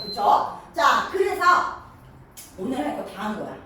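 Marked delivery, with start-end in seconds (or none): none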